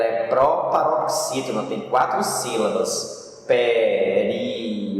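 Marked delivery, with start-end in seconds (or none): 3.5-5.0 Período drawn out slowly, pe-ri-o-do, the ri and o kept apart; slow chanted split of Período for the class; syllables separated. Shown as a demonstration. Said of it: "O ri e o ó se separam". (Período dividido em sílabas, cantado devagar)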